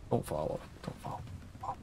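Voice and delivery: dropping to a hushed plea; tense, fading quiet pleading; anxious near-whisper. Real, not acted. Hoping enemy won't notice.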